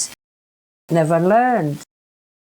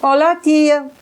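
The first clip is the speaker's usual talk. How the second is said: put-on lightly higher boyish greeting; a hint of a young boy calling auntie; 5 semitones higher than usual. Speaker imitates the boy's voice greeting her, lightly boyish. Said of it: "young boy".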